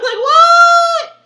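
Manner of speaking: high, loud, drawn-out shriek of disbelief; hammed-up scream of shock; shocked shout. Retelling her shocked reaction.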